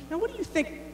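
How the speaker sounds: high, hushed interviewer voice put on; a pompous TV host leaning in with a question; mock newsman. TV interviewer asks his guest, in a high hushed put-on voice. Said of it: "he's like".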